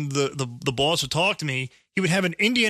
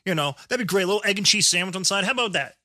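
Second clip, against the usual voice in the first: hammed-up Canadian accent, pitched up; 4 semitones higher than usual. Ordering coffee from the cashier in a put-on Canadian accent. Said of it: "spoke to the woman with a Canadian accent".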